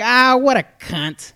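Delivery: gruff, slow Bill Burr voice; Bill Burr impression. Friend imitates Bill Burr reacting to the tweet, slow mock grumble. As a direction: drag it out like Bill Burr griping. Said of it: "in his voice".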